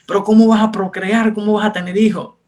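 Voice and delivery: lightly voiced worried father. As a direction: voice a fretting dad. Worried father questions him.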